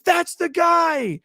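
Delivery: high, loud, drawn-out mock shriek; pissed-off accusation. Woman accuses the guest at the front desk, mock-outraged shout. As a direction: imitate an outraged woman pointing out the culprit. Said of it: "really pissed off".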